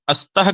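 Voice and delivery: अस्तः with a stray 'a' before the s; wrong added vowel. Shown as a demonstration. Teacher questioning a mistaken reading, wrong added vowel. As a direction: open अस्तः on a short 'a'. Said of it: "जो गलती होती है उच्चारण में".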